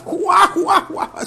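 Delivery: mock belly laugh in high spelt-out bursts; imitated guffaw. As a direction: imitate the shopkeeper roaring with laughter. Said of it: "se reía". (carcajada imitada, aguda y burlona)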